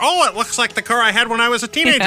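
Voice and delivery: put-on high voice of an old buyer; mock nostalgic buyer. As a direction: gush like a grandparent remembering. Imitating nostalgic car buyers.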